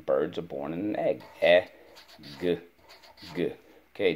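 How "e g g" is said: E-g-g sound by sound, with pauses. Shown as a demonstration. Teacher repeats egg's sounds for pupil, sounding out. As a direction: say E-g-g as three separate sounds. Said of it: "Let's go".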